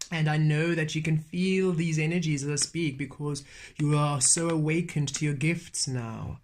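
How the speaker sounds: usual speaking voice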